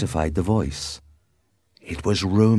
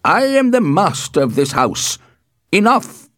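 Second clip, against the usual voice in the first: high, loud assertion of authority; irate, building to a sharp high shout; angry; 12 semitones higher, 7 dB louder than usual. A narrator's reading. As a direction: thunder it as master of the house. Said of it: "Capulet said angrily".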